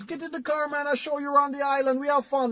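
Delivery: high-pitched Jamaican taxi driver; put-on Jamaican accent, high and sustained. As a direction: imitate an inviting Jamaican cabbie, pitched high. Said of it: "I'm gonna do my Jamaican accent".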